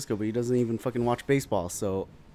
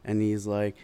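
ordinary talk, nothing marked

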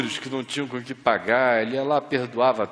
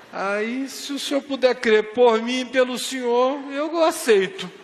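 much higher, louder, with a laugh; Barros accepting; 12 semitones higher, 7 dB louder than usual. Retold or imitated. Barros tells the lawyer he accepts, high and laughing. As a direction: squeak out the shaky yes.

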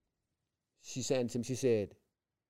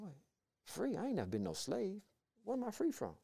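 very soft, high questioning; hushed puzzlement; 7 semitones higher, 10 dB quieter than usual. Retold or imitated. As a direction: drop to a baffled near-whisper, pitched up. Puzzled aside after the rejoicing.

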